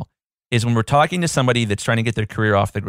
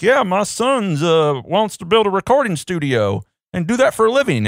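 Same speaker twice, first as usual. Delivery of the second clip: put-on dumb Southern accent; 7 semitones higher than usual. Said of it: "make them sound so dumb with the Southern accent".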